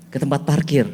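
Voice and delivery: ordinary delivery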